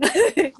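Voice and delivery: loud laugh; a loud laugh that drops off; burst of laughter. Real, not acted. Host laughs at the story.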